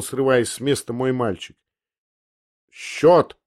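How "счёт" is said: higher, angry one-word demand; grating demand. Acted. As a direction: rap out the order in anger. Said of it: "проскрежетал Ламберт прислуги".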